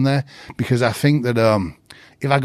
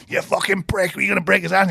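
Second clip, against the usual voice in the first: pitched-up mock rage at a student; retold angry yell; 9 semitones higher than usual. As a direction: bark it like a furious drill sergeant. Coach yells during drill.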